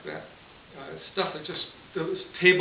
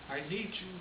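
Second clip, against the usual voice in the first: soft, higher-voiced appeal; coaxing plea; 5 semitones higher, 9 dB quieter than usual. Elderly nun asking a man for help, soft coaxing. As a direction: quietly coax a favour out of him. Nun coaxes men into helping.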